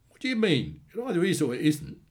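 acted indignant questioning; exasperated demand. A narrator's reading. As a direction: press for a straight answer, exasperated. Brother demands answer in quarrel.

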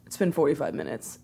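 low, soft, unbothered delivery; quiet and patient. Retold or imitated. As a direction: imitate a patient boyfriend, quietly deadpan. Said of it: "He just sits there quiet and patient".

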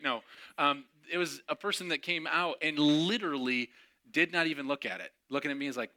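usual speaking voice

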